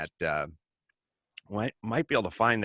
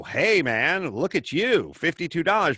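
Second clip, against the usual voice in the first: higher, louder self-congratulation; mock proud boast; 8 semitones higher, 5 dB louder than usual. Author boasting to himself over a sale, higher and louder.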